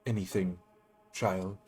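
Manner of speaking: solemn spirit voice. Acted. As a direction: intone it like an ancient guide. Guide spirit asks Reva.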